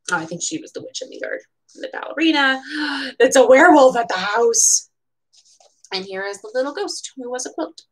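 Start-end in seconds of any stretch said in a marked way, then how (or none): 2.7-3.2 quick hushed intake of surprise; a small startled gasp; soft gasp. Real, not acted. Spotting a werewolf costume, surprised gasp. 3.2-4.9 louder, delighted exclamation; excited discovery. Real, not acted. Spotting the werewolf in the picture, excited.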